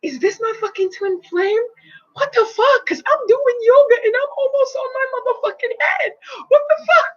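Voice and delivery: reliving the shock of the energy rush, rising to a scream; astonished disbelief. Retold or imitated. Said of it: "And I was like".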